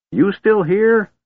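put-on very high, slow, annoyed old man. A narrator's reading. Old man glares at his gold dragon, annoyed. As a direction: draw out a high, irritated question. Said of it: "glaring at the gold dragon in annoyance".

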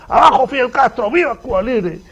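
high, loud slogan chant; acted protest shout. Escapees join the crowd's protest, high acted shout. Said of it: "se ponen a gritar también".

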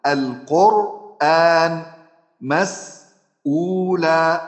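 very slow القرآن and مسؤولا, each drawn out; slow example words. Shown as a demonstration. Teacher drags out examples for the class. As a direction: spell out each example word slowly. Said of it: "مثال مثلًا".

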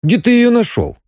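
very high, incredulous curiosity; astonished question. A narrator's reading. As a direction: quiz a friend in high-pitched amazement. Friend quizzes about new girlfriend.

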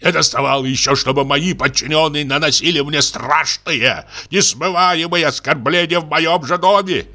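high-pitched wail of offended dignity; agitated outrage. Acted. General agitated by the insult.

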